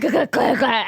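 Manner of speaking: repeated mock gagging "gah"; imitated choking noises. Student stung by the coach's advice, mock choking. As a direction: sputter as if stabbed, playfully.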